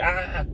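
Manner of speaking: a restrained, high "ah" mimicking an over-the-top guy; put-on crazy-guy yelp; mock yell. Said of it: "I don't wanna be like".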